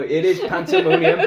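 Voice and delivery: giggling through the word pandemonium; laughing correction. Real, not acted. Friend mispronounces the word and she laughs it out, giggling. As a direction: laugh while saying pandemonium.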